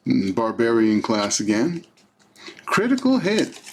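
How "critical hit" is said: excited. Real, not acted. Announcing a lucky card.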